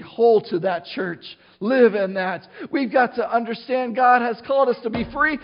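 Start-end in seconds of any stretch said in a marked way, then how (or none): none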